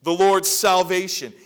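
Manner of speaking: crying out. Acted. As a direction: cry it out slowly, swelling on the last words. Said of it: "Simeon cries out".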